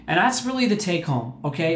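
usual speaking voice

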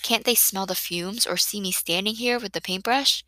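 usual speaking voice

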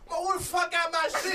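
high, laughing mock drunk demand; laughing high imitation of a drunk; mimicking drunk Kenny. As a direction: play the drunk friend hunting his phone. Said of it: "He like".

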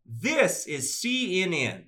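very slow, high announcer attempt in Southern accent; stretched-out, over-careful Southern announcer; attempted news ident. Shown as a demonstration. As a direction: copy a network ident with a Southern twang. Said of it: "Try this".

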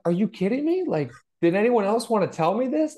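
high, loud, incredulous outburst; can't believe nobody told you, voice jumping up; stunned disbelief. Retold or imitated. Son to his mom, just told he learned it from her: loud disbelieving cry. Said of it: "And I'm looking at her like".

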